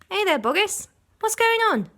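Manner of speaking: high, loud calling out; curious villager. A narrator's reading. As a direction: shout down into the pit. Villager calls into the crater.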